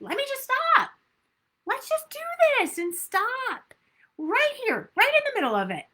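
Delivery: high, light cheerful self-talk; bright self-correction. Shown as a demonstration. Coach models stopping mid-meal, high and cheerful. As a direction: cheerfully catch yourself mid-bite. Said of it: "catch yourself going".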